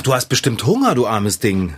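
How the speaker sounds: usual speaking voice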